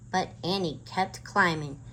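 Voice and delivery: plain reading voice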